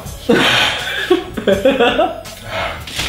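breathy laugh. Real, not acted. Laughs while eating chili.

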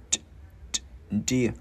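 two lone tongue pops, then "dear" as an open "di-a"; tongue-released D. Shown as a demonstration. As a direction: pop the D twice before "dear". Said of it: "Chữ đờ mình bật lưỡi gắn vào". (bật lưỡi hai lần rồi đọc "dear")

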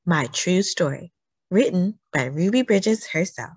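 gentle, clear storybook read-aloud; reading to children. Acted. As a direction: read it like it's story time for little kids. Reads picture book to children.

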